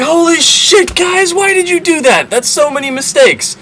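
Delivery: very high, squeaky disbelief; mock shock. Jacob scolding his sons in a high, mock-shocked squeak.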